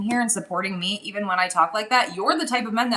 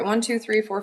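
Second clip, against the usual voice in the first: ordinary talk, nothing marked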